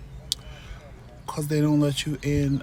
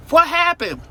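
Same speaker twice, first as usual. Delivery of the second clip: a much higher, louder, startled "what happened"; alarmed question; 5 dB louder than usual. Retold or imitated. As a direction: voice the husband rushing down, alarmed. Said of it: "Tramel came flying down the stairs like".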